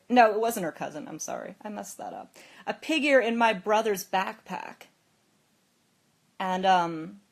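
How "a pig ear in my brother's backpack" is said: louder retold accusation; imitating an angry sister's demand; angry yelling. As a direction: press the accusation loudly. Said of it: "ran in here yelling at you".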